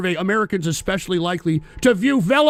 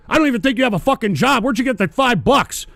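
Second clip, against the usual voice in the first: normal talking voice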